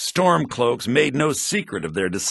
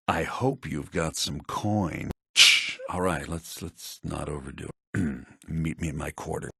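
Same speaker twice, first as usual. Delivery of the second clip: hushed and secretive, much softer; conspiratorial hush; 9 semitones lower, 7 dB quieter than usual. Acted. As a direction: keep it low so no one overhears. Secret dealing hushed in college.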